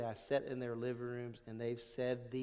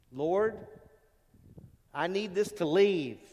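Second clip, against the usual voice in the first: pleading prayer; 4 semitones higher, 8 dB louder, slower than usual. Retold or imitated. Paul begging the Lord.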